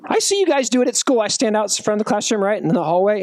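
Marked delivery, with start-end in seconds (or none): none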